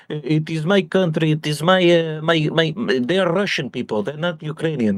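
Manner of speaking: mock Russian accent, halting; Putin impression. Putin insisting it is his country, mock Russian accent. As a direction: put on a stubborn Russian-accented voice. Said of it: "Putin's just like".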